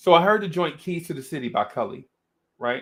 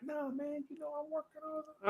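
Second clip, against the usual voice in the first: put-on high, soft, mumbled young guy's voice; Cully impression; 14 semitones higher, 19 dB quieter than usual. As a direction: do Cully, way up and hushed, laid back. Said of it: "your impression of him".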